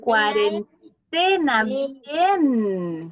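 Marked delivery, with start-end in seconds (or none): 0.0-1.6 "Cuarentena" slow and loud, broken before a strong TE; stress on TE. Shown as a demonstration. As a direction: say "Cuarentena" as cuaren-TEna, leaning on TE. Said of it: "¿Cuál es la sílaba tónica?". ("Cuarentena" fuerte y lento, marcando la sílaba TE)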